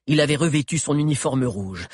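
plain reading voice

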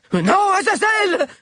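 high, loud, drawn-out scream. A narrator's reading. Chado as the caracal goes for his throat, drawn-out scream. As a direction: howl it at the attacking beast. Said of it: "hurla Chado".

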